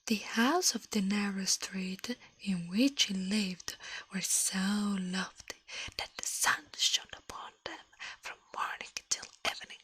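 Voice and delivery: ordinary talk, nothing marked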